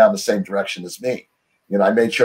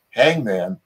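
a puzzled, half-laughing echo of the word; incredulous; 2 semitones lower than usual. Retold or imitated. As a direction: repeat it as if you can't believe the offer. Incredulous reply to cellmate.

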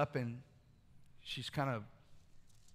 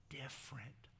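whispered; 15 dB quieter than usual. Acted. Intimate aside to congregation.

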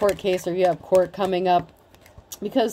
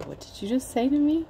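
understated menace; calm threat; 5 semitones higher, 4 dB quieter than usual. Pretend. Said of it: "because it's like".

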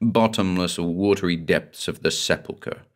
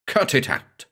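higher, sharp snap; a sharp, higher snap to stop her; snapped; 6 semitones higher than usual. Acted. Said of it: "I snapped".

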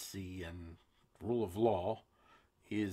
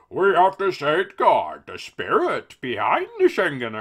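much higher, louder cartoon politician; silly Juncker voice; 6 semitones higher, 12 dB louder than usual. Host reads the EU president's quote in a silly voice. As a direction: lampoon him with a goofy pompous voice. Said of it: "make him sound silly".

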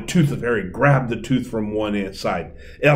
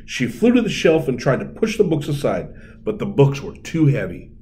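ordinary delivery

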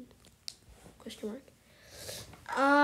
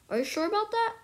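normal talking voice

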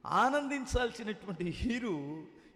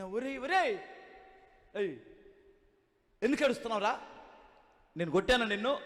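loud, stern calling out; scolding shout; 8 dB louder than usual. Retold or imitated. Elder scolds the wailing thief, loud and stern. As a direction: demand why he is crying.

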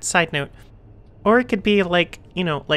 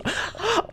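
sharp gasp; 13 semitones higher, 6 dB quieter than usual. Real, not acted. Gamer gasps at a twist, sudden high gasp. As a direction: gasp in surprise.

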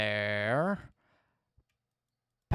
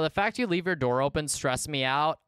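ordinary delivery

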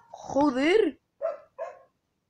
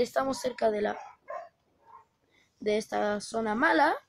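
normal talking voice

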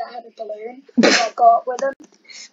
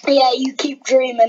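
taunting; 10 dB louder than usual. Real, not acted. Taunting rival before match.

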